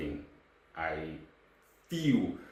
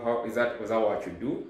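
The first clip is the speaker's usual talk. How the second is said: ordinary delivery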